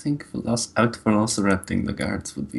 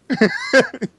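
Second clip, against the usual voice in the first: laughing; 6 dB louder than usual. Real, not acted. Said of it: "delight at the fail".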